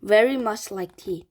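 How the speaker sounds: ordinary delivery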